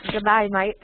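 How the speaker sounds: Aussie accent, "day" like "die"; Australian demo with "mate" like "might"; put-on Aussie greeting. Shown as a demonstration. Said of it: "have you heard an Australian accent?".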